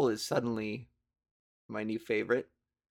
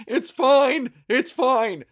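high, loud startled yell; 12 semitones higher, 9 dB louder, slower than usual. Retold or imitated. Startled by a raccoon, yelling to calm it, panicked. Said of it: "I yelled".

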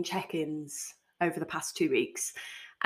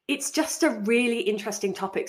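normal talking voice

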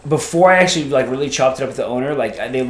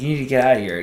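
ordinary talk

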